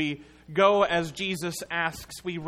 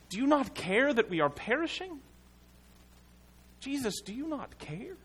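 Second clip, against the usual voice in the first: higher, desperate cry to a sleeping Jesus; anguished plea; 9 semitones higher than usual. Acted. Preacher voicing the disciples, pleading with Jesus. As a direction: plead like a frightened disciple in the storm.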